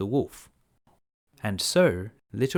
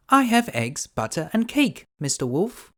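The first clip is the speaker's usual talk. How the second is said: put-on much higher, bright-eyed little girl; 8 semitones higher, 3 dB louder than usual. Girl answering the wolf, high and innocent.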